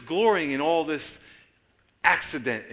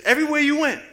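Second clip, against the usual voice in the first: much higher, louder cry of "everywhere you went"; climactic shout; 13 semitones higher, 11 dB louder, faster than usual. Real, not acted. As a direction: peak the repetition like a revival preacher. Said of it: "repeats opening phrase, climaxing".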